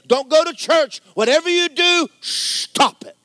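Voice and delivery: loud, high, driving sermon voice; climbing shout of a revival preacher; fiery preaching. Acted. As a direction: preach it hard and build to a peak. Preaching at full force.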